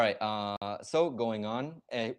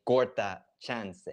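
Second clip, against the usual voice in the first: slow teaching emphasis; slower than usual. Shown as a demonstration. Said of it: "repeats the term for learners".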